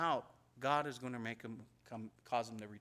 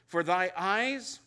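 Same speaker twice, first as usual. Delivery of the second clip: louder, higher preacher's declaration; full-force preaching; 4 semitones higher, 7 dB louder than usual. Acted. Preacher extolling God's justice and mercy to the congregation, fervent. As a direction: proclaim it to the congregation with fire.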